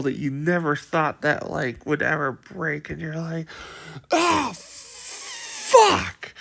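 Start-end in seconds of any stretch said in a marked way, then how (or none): 4.1-6.3 panicked scream. Retold or imitated. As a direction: shriek in sheer panic. Player terrified, enemy breaks wall.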